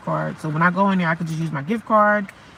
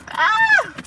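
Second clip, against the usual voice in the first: sharp high yelp; 3 dB louder than usual. Real, not acted. Sudden cry in the car.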